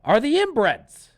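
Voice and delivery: loud, high, fed-up yell; exasperated outburst. Retold or imitated. Watching the movie, yelling loud and high at the screen. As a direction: demand to know where the inbreds are. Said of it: "I'm like".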